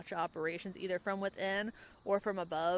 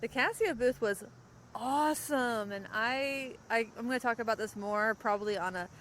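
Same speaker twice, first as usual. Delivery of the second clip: normal talking voice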